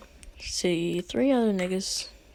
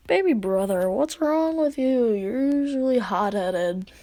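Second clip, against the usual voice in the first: stretched, swooping sing-song taunt; teasing brother; 3 semitones higher than usual. Retold or imitated. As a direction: coo it at a baby brother. Older brothers tease crying sibling.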